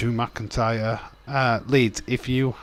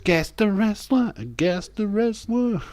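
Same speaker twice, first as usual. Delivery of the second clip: much higher, playful voice; squeaky prompt; 11 semitones higher than usual. Acted. Streamer nagging chat to guess in a squeaky voice. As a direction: chirp it like a cartoon.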